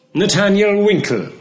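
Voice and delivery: loud, high courtroom summons; calling out. A narrator's reading. Counsel Skimpin summons the next witness, loud and high. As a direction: call the name across the hall.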